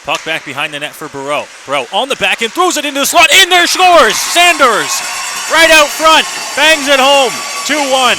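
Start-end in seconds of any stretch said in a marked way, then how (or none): 1.7-8.2 rising to a full-throated goal scream, much higher and louder; excited goal call. Real, not acted. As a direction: explode on the goal like the crowd just jumped up. Goal scored, play-by-play call.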